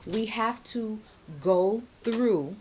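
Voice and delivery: ordinary talk, nothing marked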